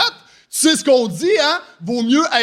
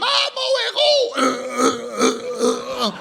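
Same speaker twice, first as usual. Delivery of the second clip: swooning damsel; 4 semitones higher, much slower than usual. Retold or imitated. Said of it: "elle est toute excitée".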